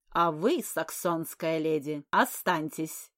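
loud, slow cold command. Acted. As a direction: hold her back with a slow order. Countess orders her to stay.

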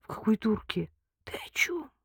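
mumbled, breath-only confusion; muttering. A narrator's reading. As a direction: trail off into a whisper. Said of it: "Лика пробормотала".

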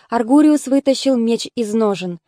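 ordinary delivery, nothing marked

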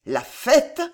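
put-on dazed drunk stammer. A narrator's reading. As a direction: stumble over it in drunken confusion. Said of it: "bégaya Montluc".

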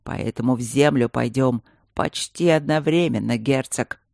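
ordinary delivery

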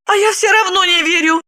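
high-pitched, timid disbelief; babbled doubt. A narrator's reading. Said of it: "пролепетала". (лепетать высоким голосом)

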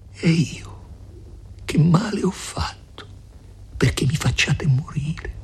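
high, soft, breath-thinned plea; frightened pleading. A narrator's reading. Lucia kneels before Renzo asking what she did, frightened pleading. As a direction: ask it high and faint, helplessly.

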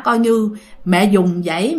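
ordinary delivery

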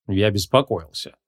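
slow, low, grudging mumble; reluctant grumble. A narrator's reading. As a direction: admit it unwillingly, dragging the words. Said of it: "неохотно проворчал Креол". (неохотное медленное ворчание)

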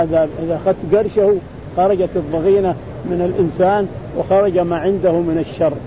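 ordinary talk

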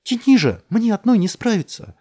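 very high, loud, desperate yell. A narrator's reading. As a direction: scream it straight at him. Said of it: "заорала на него Элис". (орёт отчаянно, во весь голос)

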